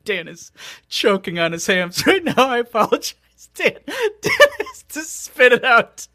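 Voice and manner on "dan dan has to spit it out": laughing. Real, not acted. Laughing at Dan choking.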